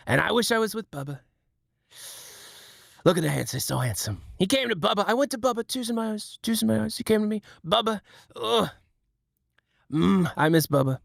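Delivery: high, whiny Trump voice; Trump impression. Streamer showing chat his Trump, high and whiny. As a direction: moan about Bubba like a whiny Trump. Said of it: "I can do Trump".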